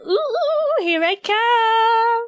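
high, drawn-out singsong approach; playful excited squeal. Real, not acted. Streamer moving closer to viewers, playful squeal. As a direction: stretch it out high as you swoop in.

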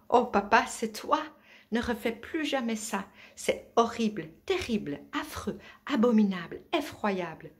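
high, loud, overwrought little wolf; loupiot affolé. A narrator's reading. Frightened young wolf scolds his father. (voix aiguë et forte, indignation théâtrale)

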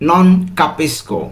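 "non capisco" stretched out very slowly, every syllable clear; slow model answer. Shown as a demonstration. Teacher revealing the right answer very slowly. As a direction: draw out "non capisco" for the learners. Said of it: "এর সঠিক উত্তর হবে".

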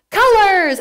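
high, loud voice-over; show announcer. Retold or imitated. TV show voice-over announces the word high and loud. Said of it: "you hear someone say".

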